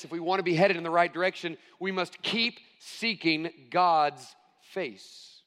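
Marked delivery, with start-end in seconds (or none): 1.8-5.4 each word spaced out for note taking; slow emphatic dictation. Shown as a demonstration. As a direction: say it slowly so they can write it down. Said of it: "I encourage you to write that down".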